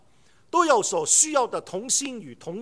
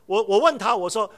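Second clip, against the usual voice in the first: ordinary delivery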